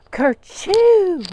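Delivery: high, very loud kerchoo sneeze; big sneeze. A narrator's reading. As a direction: explode into a cartoon sneeze. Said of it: "went Robert".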